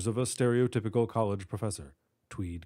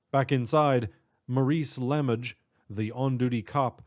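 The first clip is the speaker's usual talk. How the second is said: plain reading voice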